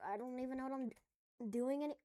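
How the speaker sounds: ordinary delivery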